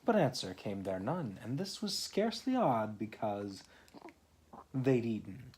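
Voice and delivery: lively read-aloud poem voice; story reading. Acted. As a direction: read the rhyme to a small child. Reads poem aloud to child.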